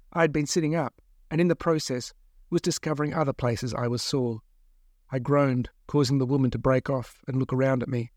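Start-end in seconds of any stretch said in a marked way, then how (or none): none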